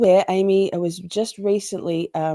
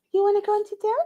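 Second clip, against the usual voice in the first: dog voice; much faster than usual. Real, not acted. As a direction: coo at the pet in a high voice. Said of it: "That's my dog voice".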